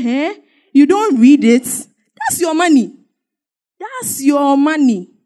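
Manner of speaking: slow, pointed emphasis on your money; insistent urging. Retold or imitated. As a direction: press him hard to see it's his money. Urging the man to read.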